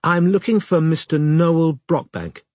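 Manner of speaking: measured, lightly put-on lawyer persona; Venetia. A narrator's reading. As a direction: state the business slowly, as a lawyer would. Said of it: "said Robin as Venetia".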